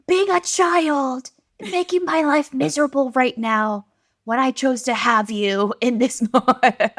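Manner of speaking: high, loud mock wail; drawn-out dramatic lament; unhinged tired mom. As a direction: ham up a parent at the end of their rope. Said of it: "The unhinged, tired mom".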